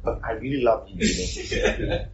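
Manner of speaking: playfully overdone declaration of affection; mock protestation of love. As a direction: protest your love after refusing to help. Mimics insincere professed love while refusing.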